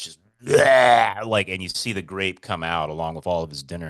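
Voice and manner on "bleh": loud retching bleh; vomit noise. Retold or imitated. Imitating the dog throwing up, loud retch. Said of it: "projectile vomits".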